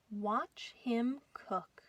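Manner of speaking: usual speaking voice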